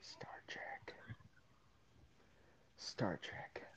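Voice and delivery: breathy mock whisper. Real, not acted. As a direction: whisper it under your breath. Mocking his co-host's favourite pick.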